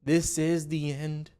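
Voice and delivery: hammed-up end-of-the-world sigh, drawn out slow; doom-saying investor. Mocking the naysayers who panic over one day, quiet and slow. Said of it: "all the people out there like".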